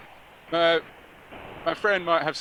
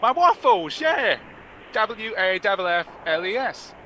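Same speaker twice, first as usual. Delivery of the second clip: put-on thick London accent, pitched higher; half London accent; 6 semitones higher than usual. London caller asking a front desk for a waffle refund, thick accent. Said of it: "half London accent".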